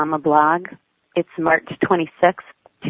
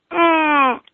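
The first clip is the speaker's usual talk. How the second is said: defiant hum; 11 semitones higher than usual. A narrator's reading. Child refuses, kicks again.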